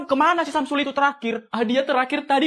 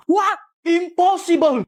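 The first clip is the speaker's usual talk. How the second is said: shocked shout; 4 semitones higher, slower than usual. Acted. Neighbour shouting in shock at the news. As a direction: blurt it out stunned.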